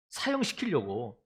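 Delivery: ordinary talk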